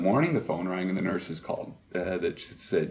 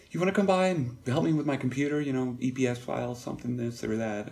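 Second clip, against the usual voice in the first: mother's voice; 6 semitones higher than usual. Retold or imitated.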